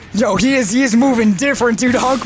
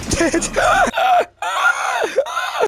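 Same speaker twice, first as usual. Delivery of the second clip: high shriek laugh. Real, not acted. Caught by soldiers.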